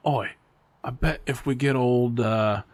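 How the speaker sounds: lightly faked Aussie teacher; half-hearted Australian accent, laughter at the end; attempted Australian accent. Teachers plotting about the new guy, attempted Australian accent. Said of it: "with your look of my accent".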